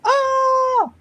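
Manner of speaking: high, loud shocked shriek; imitated startled scream. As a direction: scream like the girl in the cartoon. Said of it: "the little girl goes".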